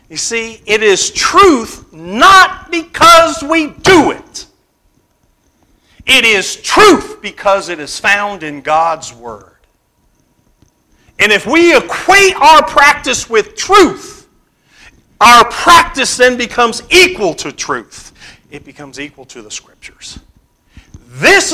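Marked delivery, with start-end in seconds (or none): 0.7-4.5 slow, higher and louder, punching "not"; emphatic preaching. Acted. Preacher stresses key claim. 11.2-18.1 higher, louder pulpit voice; insistent preaching. Acted. Preacher repeats key claim.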